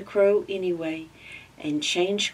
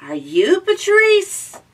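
very high, loud, slow turtle squeak; 14 semitones higher, 10 dB louder than usual. Acted. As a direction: squeak it slowly like a tiny turtle. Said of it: "asked the turtle".

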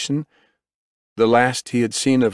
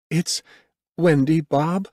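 higher woman's voice; medic breaking news; 9 semitones higher, slower than usual. A narrator's reading. Medic to the father about his child, high voice. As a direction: break it to him, pitched well up.

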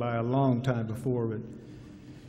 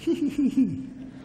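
little high-pitched giggle; Al Baxter giggle; 11 semitones higher than usual. Retold or imitated. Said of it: "give a little Al Baxter giggle".

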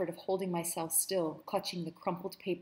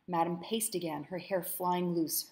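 ordinary delivery

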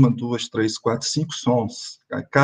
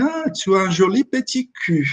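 leve imitação de um francês elogiando; lightly voiced Frenchman paying a compliment; a French speaker; 6 semitones higher than usual. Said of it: "eles falam assim". (francês imitado de leve)

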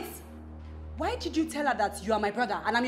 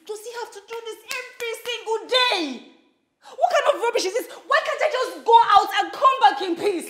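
loud, higher, fast exasperated yelling; furious rant; 6 semitones higher, 13 dB louder than usual. Acted. Fed-up wife ranting at her husband, loud and furious. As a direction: unload every grievance on him.

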